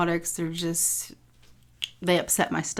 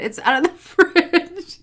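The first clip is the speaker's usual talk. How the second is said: laughing; 11 semitones higher, 6 dB louder, slower than usual. Retold or imitated. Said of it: "and I said".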